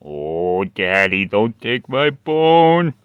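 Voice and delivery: dog owner voicing his pup's plea. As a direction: speak for the dog begging to keep his bone. Voicing the dog's thoughts.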